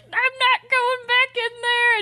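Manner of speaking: put-on high, slow, wavering old man's voice; cling on and refuse like a frail old man; stubborn old man. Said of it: "grab onto a tree or something and be like".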